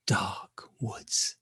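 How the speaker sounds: usual speaking voice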